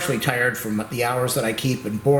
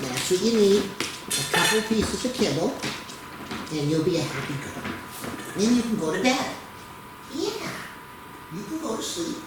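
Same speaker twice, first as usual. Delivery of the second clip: higher, sing-song treat talk; high doting pet voice; 4 semitones higher than usual. Real, not acted. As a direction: tempt her with a snack, sweetly. Offering the dog a snack.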